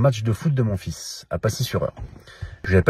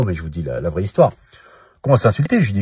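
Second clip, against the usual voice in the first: usual speaking voice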